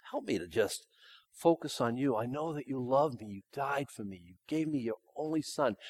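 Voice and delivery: soft, humble prayer voice; gentle, hushed prayer; model prayer. Shown as a demonstration. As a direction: pray it quietly and sincerely. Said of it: "So what do you do?".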